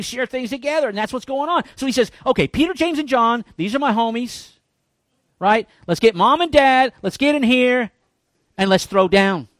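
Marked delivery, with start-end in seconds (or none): 2.3-9.5 casual Jesus. Retold or imitated. Jesus voiced gathering his disciples.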